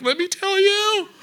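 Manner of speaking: high squeaky mock whine; put-on voice leaping way up in pitch; eager oversharer. Oversharer answering "how are you, brother", high hammed-up voice.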